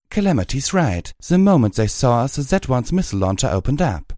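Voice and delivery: high, urgent protest; urgent mare voice. A narrator's reading. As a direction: defend your friend quickly. Velvet Remedy urgently defending.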